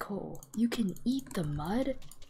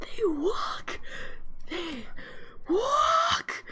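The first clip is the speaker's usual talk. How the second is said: much higher, squealing with delight; 13 semitones higher, 5 dB louder, much slower than usual. Real, not acted. Player squealing at frogs walking around. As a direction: squeal like the cutest thing ever.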